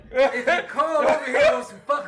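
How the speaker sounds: loud, high, half-laughing warning. Real, not acted. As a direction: bellow it through a laugh. Repeated warning, louder, laughing.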